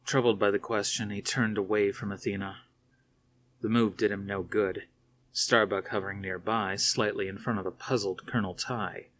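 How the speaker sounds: plain reading voice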